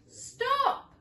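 high, loud shout; old man shouting after the gingerbread man; cry of stop. Acted. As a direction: yell after the runaway. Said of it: "cried the little old woman".

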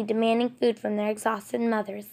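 ordinary delivery, nothing marked

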